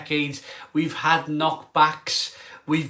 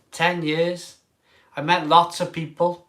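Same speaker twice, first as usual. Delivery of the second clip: ordinary delivery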